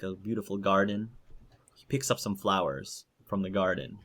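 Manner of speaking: usual speaking voice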